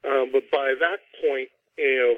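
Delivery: normal talking voice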